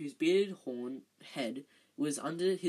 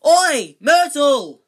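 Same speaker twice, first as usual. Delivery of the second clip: full-force high bellow; 11 semitones higher, 22 dB louder, much slower than usual. A narrator's reading. Peeves calling Myrtle over, bellowing. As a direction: holler it out long and loud. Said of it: "took a deep breath and bellowed".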